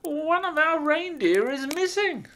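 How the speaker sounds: high, loud hesitant elf voice; little old elf. A narrator's reading. Elf hedging his answer to Santa, high and hesitant. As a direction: squeak it out nervously as the elf.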